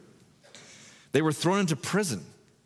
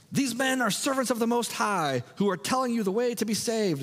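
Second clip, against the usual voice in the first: much higher, sing-song mockery of the preachers; mocking funny voice; 10 semitones higher than usual. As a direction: parrot it back like a taunting fortune teller. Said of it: "saying in a funny voice ... like mockery".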